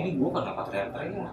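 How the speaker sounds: softly voiced puzzled thought; hushed aside to oneself; inner voice. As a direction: say it quietly to yourself. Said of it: "Tapi dalam hati ngomong".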